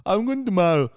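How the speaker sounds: fast slurred native-speaker English; imitating a local's quick mumble; mumbled American English. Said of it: "اگه یکی به من بگه".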